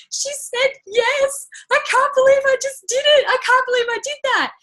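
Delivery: high, teary imitation of the sister sobbing happily; sobbing with joy. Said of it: "she started just absolutely sobbing".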